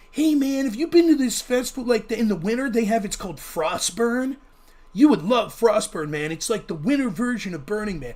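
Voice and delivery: fake hippie. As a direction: pitch a festival like a mellow hippie. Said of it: "my fake hippie voice there".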